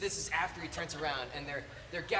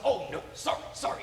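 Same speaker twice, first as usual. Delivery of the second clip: raised, mildly frustrated villain; louder, flustered apology in character; Sideshow Bob; 6 semitones higher, 7 dB louder than usual. Acted. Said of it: "he's, like, frustrated".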